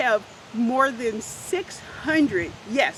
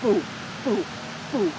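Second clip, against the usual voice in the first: short, separated beats; imitated heartbeat; 3 semitones lower than usual. Shown as a demonstration. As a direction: sound out a steady pumping heart. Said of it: "So it does like this".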